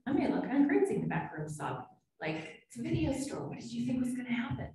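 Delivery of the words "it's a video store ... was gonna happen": high, fast, scoffing "what did you expect"; mock incredulous onlooker. Imagined onlooker scoffing at her crying in the staff lounge, mock incredulous. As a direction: brush it off like a dismissive bystander.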